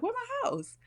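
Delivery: sudden squeaky pitch jump, mock alarm; startled high question. Girl coming home to no house, high mock-startled. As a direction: imitate a girl finding her house gone, half-joking. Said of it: "then she's like".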